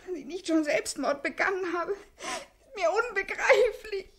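tearful hysterics; hysterical sobbing. Acted. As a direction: break into sob-laughter. Crying with laughter, loud.

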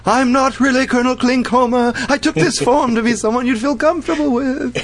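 high, put-on character voice; colonel impression. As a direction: play the disguised colonel, hammed up. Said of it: "it was like".

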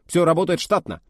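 high, loud self-important report. Acted. Officer chiming in for the admiral, loud pompous report. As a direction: puff up and announce it. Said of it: "важно подхватил Киврин".

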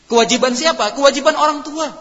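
loud, high rhetorical question and answer; emphatic preaching. Real, not acted. Preacher putting the duty on parents, emphatic and loud. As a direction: ring it out forcefully to the congregation.

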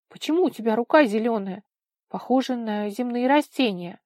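loud, insistent questioning; demanding. A narrator's reading. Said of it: "требовательно спросила она". (требовательно, громче обычного)

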